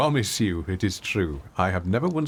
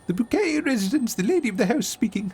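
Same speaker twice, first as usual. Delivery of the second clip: much higher, prim posh-lady phone answer; Hyacinth Bucket; 12 semitones higher than usual. Retold or imitated. Answering the phone as Hyacinth, snobbishly high-pitched. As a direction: trill it like a snooty lady of the house. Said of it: "Hyacinth Bucket, or Hyacinth Bouquet".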